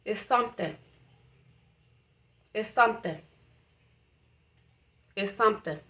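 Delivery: near-silent, overcome with feeling; barely gets the words out, fighting tears; emotional, choking up. Real, not acted. To her viewers after a long pause, softly overcome. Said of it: "I got emotional".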